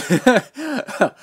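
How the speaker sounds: quick amused laugh, high; a bright burst of laughter; short laugh. Real, not acted. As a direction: laugh it off at the flaw. Laughs at bubbled paint.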